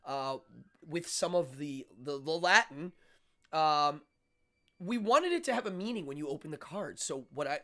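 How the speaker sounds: ordinary talk, nothing marked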